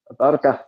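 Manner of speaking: "burger" said bar-guh with a sounded r; Scottish burger. Shown as a demonstration. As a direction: say "burger" the Scots way, tapped r. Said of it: "got to grips with in Scottish was burger".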